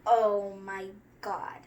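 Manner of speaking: ordinary delivery, nothing marked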